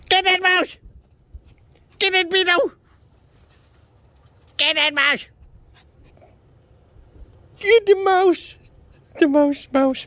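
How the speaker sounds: high, loud excited screaming; high loud yell urging the dog on. Real, not acted. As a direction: scream at the dog to rile her up. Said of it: "Coughing from screaming".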